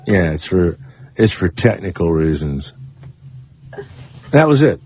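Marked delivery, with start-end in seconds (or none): 0.1-2.7 a lower, dry, matter-of-fact engineer; lightly voiced deadpan engineer; imitating the engineer. Host mimicking the engineer's curt explanation, lightly deadpan. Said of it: "And he talks like this".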